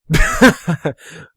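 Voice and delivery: high loud self-mocking laugh; burst of laughter. Real, not acted. Vlogger laughing at himself, real burst of laughter. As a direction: laugh off the boast.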